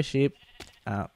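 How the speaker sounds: ordinary delivery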